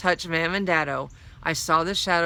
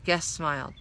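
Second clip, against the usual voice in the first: plain reading voice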